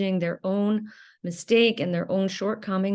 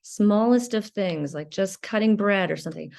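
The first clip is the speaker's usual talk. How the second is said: usual speaking voice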